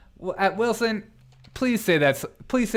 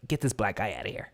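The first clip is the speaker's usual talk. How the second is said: low, quick, softer dismissive voice; mock biased boss; 7 semitones lower, 8 dB quieter, faster than usual. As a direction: play the hirer dismissing someone under the breath. Imitates a biased hirer.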